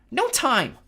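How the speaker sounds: high, loud cry; urgent cry. A narrator's reading. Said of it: "Tempest cried".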